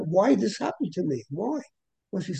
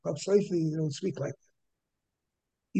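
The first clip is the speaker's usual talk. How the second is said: softer, slow self-reproach; quiet rebuke; 4 semitones lower, 7 dB quieter, slower than usual. Retold or imitated. Rabbi rebuking himself over the Chacham Sofer, slow and soft. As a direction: lower the voice and slowly admonish yourself. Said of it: "should not have spoken like that".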